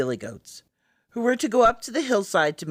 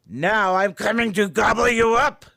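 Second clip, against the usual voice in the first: loud, higher threat swelling to the end; menacing troll; 5 semitones higher, 6 dB louder than usual. A narrator's reading. Troll threatens to gobble the second goat, loud and menacing. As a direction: growl it out as a hungry troll. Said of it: "roared the troll".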